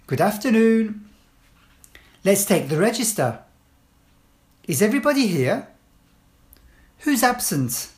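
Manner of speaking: firm, clear classroom instructions; teacher giving commands. Shown as a demonstration. Teacher to pupils entering class, firm clear commands.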